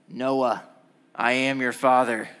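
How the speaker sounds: lightly put-on villain voice; a hint of a movie villain calling out to a victim; mock-ominous prank announcement; Darth Vader. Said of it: "my Darth Vader voice".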